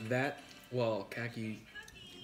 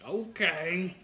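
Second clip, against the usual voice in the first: higher, louder drawn-out impression; 6 semitones higher, 5 dB louder than usual. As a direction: pitch it up like a hype rapper's catchphrase. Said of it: "quoting a [name]".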